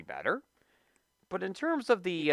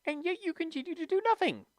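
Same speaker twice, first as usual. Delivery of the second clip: high-pitched, fast, building to a loud demand; exasperated scolding; 13 semitones higher, 3 dB louder, faster than usual. Acted. Host berating a knucklehead with connections, high and exasperated. Berating an imagined industry complainer.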